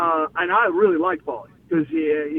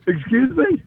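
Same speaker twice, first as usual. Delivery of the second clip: higher-pitched retold disbelief; taken aback; 8 semitones higher than usual. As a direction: act out being thrown by a strange request. Stunned by an odd request.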